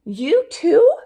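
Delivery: high, loud, startled; surprised. Acted. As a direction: yelp it in disbelief. Homer surprised.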